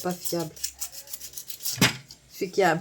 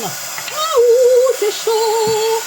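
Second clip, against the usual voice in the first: surprised yelp; 13 semitones higher, 9 dB louder, much slower than usual. Real, not acted. Hot paint, real surprise.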